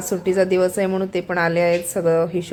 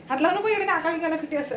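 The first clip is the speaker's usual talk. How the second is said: sharp warning; 8 semitones higher than usual. Real, not acted. Scolding husband cleaning with bleach.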